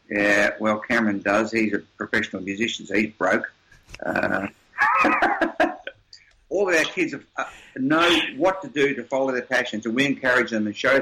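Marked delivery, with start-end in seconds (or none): 4.8-5.9 a quick burst of laughter; brief amused chuckle; short laugh. Real, not acted. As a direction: laugh at your own joke. Laughs at own joke about broke son.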